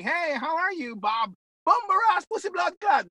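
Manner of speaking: mock breezy greeting. Mocking a learner who greets a friend with slurs, breezy and high. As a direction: toss the insults out like a cheerful hi. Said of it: "like you're saying".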